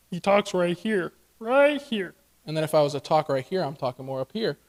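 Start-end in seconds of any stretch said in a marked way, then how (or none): 0.1-1.1 slow, voice set far back; Kermit-like throat placement. Shown as a demonstration. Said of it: "he talks all the way back here". 1.4-2.1 higher, strained back-of-throat voice; squeezed Stitch-like placement. Shown as a demonstration. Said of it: "straining your voice a little bit".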